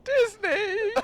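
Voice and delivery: high, loud, excited shout of Disney; high excited yell; excited cry. Real, not acted.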